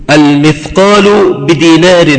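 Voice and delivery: ordinary talk, nothing marked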